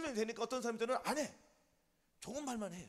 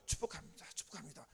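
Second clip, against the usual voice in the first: soft, half-voiced murmur; hushed blessing; 7 semitones lower, 8 dB quieter than usual. Preacher models blessing someone who wronged him, hushed murmur. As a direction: say it under your breath, fading away.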